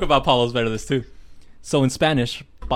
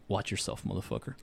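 ominous hush; 3 semitones lower, 12 dB quieter than usual. Retold or imitated. Ominous alien message.

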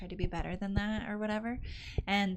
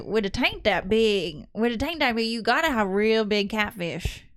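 put-on country accent; 6 dB louder than usual. Retelling a swamp tourist's question to friends, loud country accent. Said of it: "I don't know if I can do the accent".

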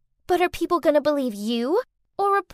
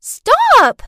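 loud, higher shout of stop; 5 semitones higher, 9 dB louder than usual. Acted. Girl shouts to stop the couple leaving, loud. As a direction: bark it to halt them. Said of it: "Clarice shouted".

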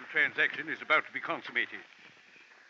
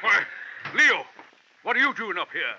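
startled; 7 semitones higher, 5 dB louder than usual. Acted. Colonel startled by Leo's arrival.

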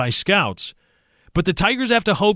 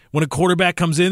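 ordinary talk

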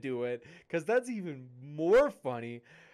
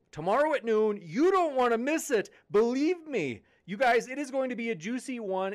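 higher and louder, pumped-up promo push; excited hype; 6 semitones higher, 5 dB louder than usual. Real, not acted. Streamer hyping tomorrow's debate to chat, excited and loud. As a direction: sell the noon debate to the chat, bursting with excitement.

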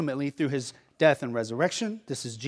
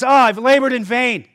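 loud, higher cry of anguish, acted out; despairing cry; 6 semitones higher, 7 dB louder than usual. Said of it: "Temptation to despair".

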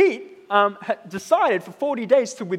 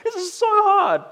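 lightly raised, strained voice of a friend fasting; a hint of Pete struggling with cravings; imitating Pete; 12 semitones higher, 3 dB louder than usual. Pastor quoting a fasting friend, lightly strained and higher. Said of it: "He said this".

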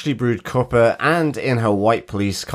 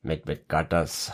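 softer, lower Scottish advert slogan; Scottish accent; 5 semitones lower, 8 dB quieter than usual. Shown as a demonstration. Co-host recalling a childhood advert, Scottish accent. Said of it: "the Iron Brew and Tizer adverts".

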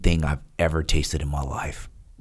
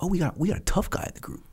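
much higher, fast taunting instructor; mocking instructor; 12 semitones higher, 5 dB quieter, faster than usual. Instructor taunts the cocky trainee, high mocking voice. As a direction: sneer at the tough guy. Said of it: "he was just like".

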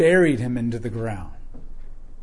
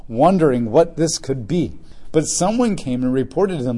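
raised, measured Bible reading voice; scripture reading; 5 dB louder than usual. Acted. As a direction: read the passage out to the church. Reads scripture aloud to congregation.

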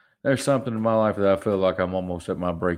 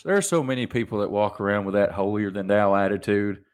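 usual speaking voice

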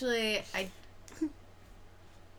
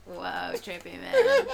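slow, dazed stoner drawl, laughing; a spaced-out "whoa, trippy"; stoned voice; 6 dB louder, slower than usual. Retold or imitated. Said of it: "I was like".

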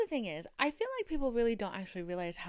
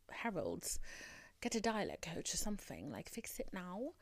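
softer, lower deadpan plea; mock aside to a star; 3 semitones lower, 9 dB quieter than usual. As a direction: drop your voice for a mock scolding. Mock advice addressed to [name].

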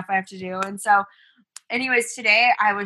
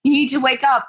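higher-pitched mock call to a child; retold frantic wake-up call; 5 semitones higher, 4 dB louder than usual. Mom waking her seven-year-old, mildly frantic. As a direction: imitate a parent losing patience, raised voice. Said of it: "I started going crazy".

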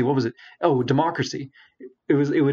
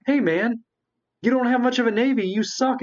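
taunting rival; 11 semitones higher than usual. Retold or imitated. Rival nation voiced taunting.

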